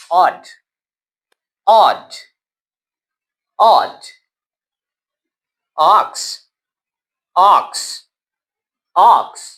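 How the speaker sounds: Odd and ox with a short open o; short o drill. Shown as a demonstration. Teacher drills words for repetition, short o. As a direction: repeat Odd then ox clearly, short o each time. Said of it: "ओ की आवाज आपने short रखनी है".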